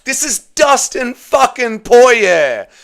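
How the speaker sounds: high, slow and crying; tearful and emphatic. Real, not acted. Streamer crying over Dustin's last fight, tearful. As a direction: choke out each word through tears. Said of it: "I'm still crying".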